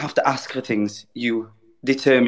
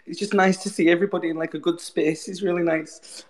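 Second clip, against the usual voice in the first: teary happy crying; 5 semitones higher than usual. Real, not acted. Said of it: "I'm crying now as I'm talking".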